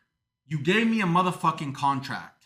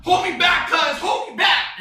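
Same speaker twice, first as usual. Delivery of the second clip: high, loud mock shout; mock dwarf fight shout; 11 semitones higher, 4 dB louder than usual. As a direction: yell like a riled-up little brawler begging to be held back. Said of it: "when two dwarfs are fighting".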